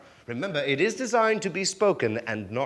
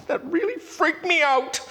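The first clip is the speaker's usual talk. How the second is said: suddenly pitched up high, casual; freaked out; 11 semitones higher than usual. Acted. Playwright admits fear to the jury, voice jumping high. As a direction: break character into modern panic. Shakespeare drops his grand tone.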